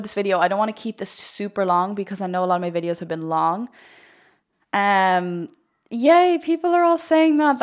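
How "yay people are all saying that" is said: high, loud delighted cheer; so happy. Real, not acted. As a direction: squeal with real joy at the viewers. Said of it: "That makes me so happy".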